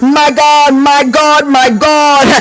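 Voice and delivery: rising, fervent preacher cadence with shouted cries; preaching at full force. Acted. Preacher stirring up the congregation, fervent and shouting.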